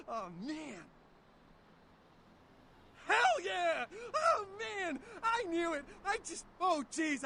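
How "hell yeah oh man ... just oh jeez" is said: thrilled shout. Acted. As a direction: cheer it like a fanboy. Ghoul thrilled to meet vampire.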